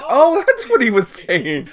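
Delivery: high, slow, laughing; laughing realization. Retold or imitated. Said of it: "I'm like".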